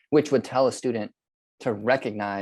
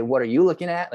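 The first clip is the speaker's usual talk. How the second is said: mock hostile retort, louder and higher; sullen student snapping; 6 semitones higher, 6 dB louder than usual. Frustrated student snapping back at the teacher, mildly mocked. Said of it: "they're scuffing their heels and they're folding their arms".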